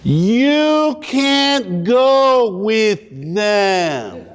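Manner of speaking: slow, drawn-out condescending teacher; teacher's condescending voice. Retold or imitated. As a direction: talk down to the new kid, slowly. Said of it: "she looked at me and she goes".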